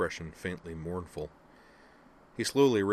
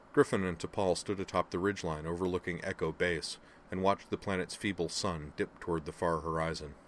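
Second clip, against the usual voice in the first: ordinary delivery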